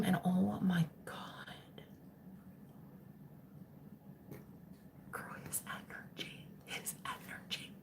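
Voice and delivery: breathy unvoiced whisper of excitement. Real, not acted. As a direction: confide it like a secret. Confiding excitedly about his energy.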